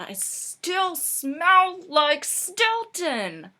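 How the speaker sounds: slow, high, loud wail; drawn-out mock anguish; dismayed outburst. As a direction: cry out in comic despair. Discovers shirt still stinks.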